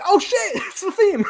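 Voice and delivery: high, loud thrilled outburst with laughter; freaking out. Retold or imitated. Fan recognising the theme, excited freak-out. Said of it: "I legit freaked out for a second".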